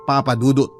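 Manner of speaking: normal talking voice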